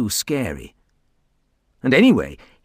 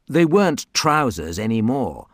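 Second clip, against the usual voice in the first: plain reading voice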